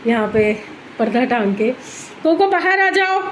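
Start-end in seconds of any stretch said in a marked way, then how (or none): 2.2-3.2 high-pitched call to a pet. Real, not acted. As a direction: summon the dog from another room, pitched right up. Calling Coco to come out. (ऊँची आवाज़ में पुकारते हुए)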